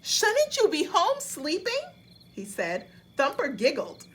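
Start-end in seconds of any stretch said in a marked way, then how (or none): 0.0-1.8 high, loud startled squeak of a question; a wide-eyed opossum caught off guard; surprised little critter voice, pitched high. Acted. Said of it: "Dizzy was very surprised".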